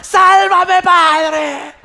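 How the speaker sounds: high, full-volume mock shriek; screaming for help. Mother begging God on the slide, acted screaming. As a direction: cry out to heaven in hammed-up panic. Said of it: "empiezo a escuchar los gritos".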